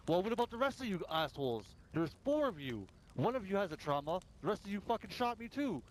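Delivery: high, heated demand; retold angry challenge. Player demanding why the rest of the gang shot him, high heated. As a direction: call out the whole gang. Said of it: "And I'm like".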